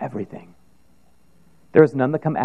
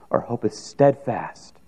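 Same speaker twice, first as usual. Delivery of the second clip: usual speaking voice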